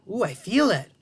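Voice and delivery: normal talking voice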